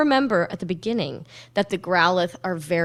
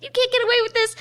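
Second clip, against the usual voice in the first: very high protesting voice; high squeaky Ash; 14 semitones higher, much faster than usual. Retold or imitated. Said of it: "Ash goes".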